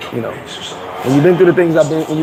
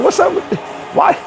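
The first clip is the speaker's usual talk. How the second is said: mock baffled question; 12 semitones higher than usual. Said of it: "be like".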